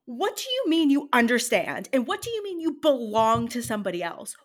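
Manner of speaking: loud, high indignant questioning; incredulous outrage. Retold or imitated. Co-host retells reacting to the character's line, incredulous and indignant. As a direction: challenge it in exasperated disbelief.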